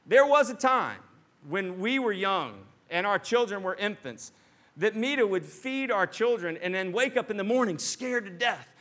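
ordinary delivery

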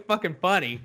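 normal talking voice

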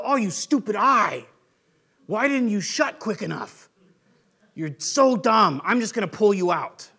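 lightly voiced exasperation, slowing to scold; mock scolding. Preacher scolding his own eye, hammed-up annoyance. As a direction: chide the eye like a fool.

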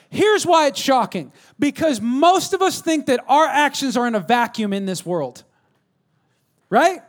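high, emphatic pulpit delivery; full-force preaching. Real, not acted. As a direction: drive the point home in stretched phrases.

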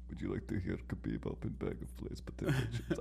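Khabib ASMR voice. Pretend. Friend repeating the pretend Khabib ASMR offer, soft accent. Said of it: "Khabib A S M R video".

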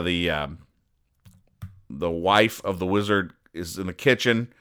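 ordinary talk

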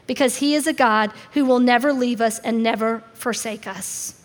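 ordinary delivery

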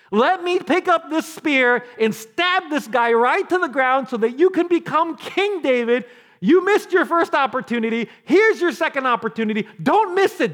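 loud, high, insistent urging; Abishai's urgent goading. Acted. Abishai urging David to take his second chance, loudly insistent. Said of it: "Abishai's like".

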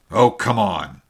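loud, impatient outburst; cried out. A narrator's reading. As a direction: call it out hard, done waiting. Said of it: "he cried at last".

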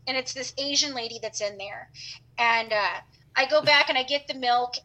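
ordinary delivery

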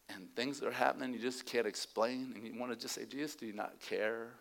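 soft, low near-whisper; preacher speaking softly to the hurting; hushed intimate. Real, not acted. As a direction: draw them in with a hush. Preacher drops to intimate hush.

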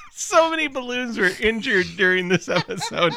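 shrieking laughter then laughing words; laughing hard. Real, not acted. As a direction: crack up while talking. Cracking up at the episode.

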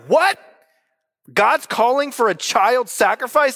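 high, rising, incredulous "what"; mock shock. First-time Bible reader reacting to God's command, shocked disbelief. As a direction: act taken aback, pitch jumping up.

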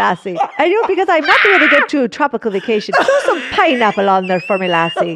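laughing, botched Canadian accent turning Irish; Canadian accent gone Irish. Shown as a demonstration. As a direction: let the Canadian accent slide into mock Irish. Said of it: "We're gonna end in Ireland now".

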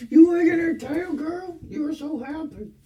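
high squeaky pet voice. Real, not acted. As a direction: coo at the dog in a sky-high voice. Talking to her dog.

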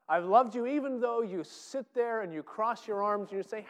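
rising passionate plea as God; impassioned God. Acted. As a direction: plead hard with arms-crossed people. God pleading with sulking people.

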